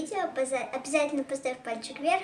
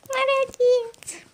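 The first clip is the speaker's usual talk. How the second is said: high, slow drawn-out praise; praising a pet; 11 semitones higher, 4 dB louder, much slower than usual. Real, not acted. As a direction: sing out the praise to the dog. Хвалит собаку после прыжка. (ласковая похвала)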